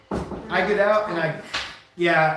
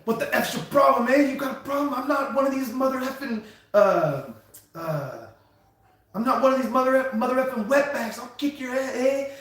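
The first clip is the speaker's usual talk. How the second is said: higher, aggressive tough-guy challenge; mock tough-guy threat; 7 semitones higher than usual. Trucker imitating a driver threatening him at the scale. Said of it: "he's like".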